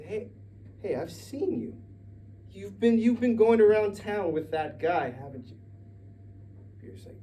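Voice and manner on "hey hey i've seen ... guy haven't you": accusing stranger. Acted. Stranger accuses Peter at night.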